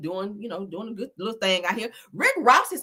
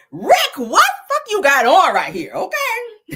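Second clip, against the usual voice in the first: loud, high exclamation breaking into laughter; laughing disbelief; 8 semitones higher, 8 dB louder, slower than usual. Real, not acted. Host scolding [name] over his outfit, loud laughing disbelief. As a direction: call him out loudly while cracking up.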